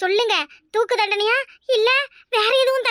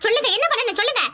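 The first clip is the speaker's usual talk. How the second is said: ordinary talk, nothing marked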